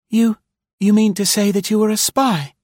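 high-pitched, alarmed woman's voice; frightened. A narrator's reading. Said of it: "quivering with terror".

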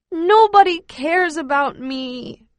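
high, slow, loud self-pitying moan. Acted. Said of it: "I moan".

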